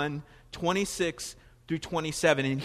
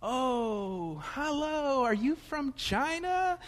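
high, slow, sweetly clueless voice; imitating the lady asking my wife; bookstore lady; 8 semitones higher, much slower than usual. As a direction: mimic a naive saleslady, pitched up.

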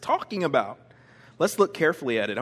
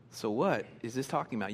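usual speaking voice

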